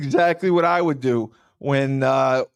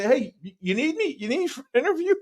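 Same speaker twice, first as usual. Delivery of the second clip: very high mock-eager offer; teasing; 12 semitones higher, faster than usual. Fan teasing Kyle about an interview, high mock-eager. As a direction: needle him, faking keenness.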